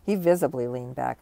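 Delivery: usual speaking voice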